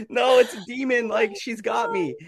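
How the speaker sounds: high, slowed, hammed-up dread; high mock-horrified voice; mock terror. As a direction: wail that the demon has you. Fear of demon.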